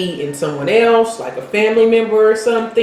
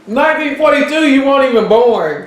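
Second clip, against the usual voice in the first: teasing mimic; 7 semitones higher than usual. Real, not acted. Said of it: "Stop doing that".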